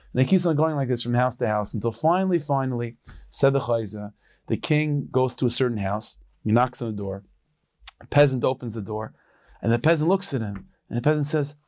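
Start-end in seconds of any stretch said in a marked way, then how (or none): none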